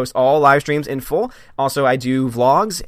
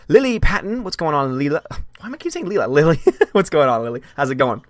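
ordinary talk

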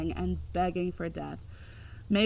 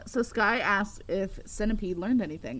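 ordinary talk, nothing marked

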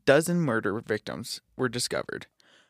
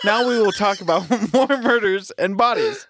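laughing; 7 semitones higher, 8 dB louder than usual. Real, not acted. Reads while laughing.

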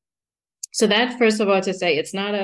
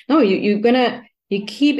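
ordinary talk, nothing marked

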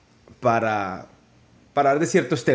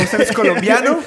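higher and louder, laughing disbelief; mock incredulous officer; 6 semitones higher, 5 dB louder than usual. Host mimics the officer doubting the guest, laughing disbelief. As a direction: play the shocked migration officer.

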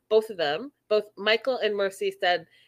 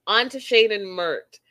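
usual speaking voice

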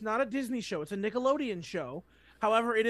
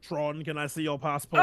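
low villain voice, breaking into a laugh; bad guy voice; 7 semitones lower than usual. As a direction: play the movie villain, half laughing. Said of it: "I want you to say".

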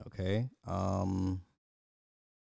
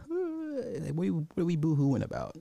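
mock crying; 9 semitones higher, 3 dB louder than usual. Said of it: "watching this movie like hurt little women".

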